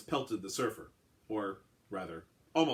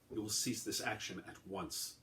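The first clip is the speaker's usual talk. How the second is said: softer, slow, distant alien calm; cold command; 8 dB quieter than usual. A narrator's reading. Surfer ordering the gunman to stop, cold and soft. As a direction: state it as an order, unmoved. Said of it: "he said".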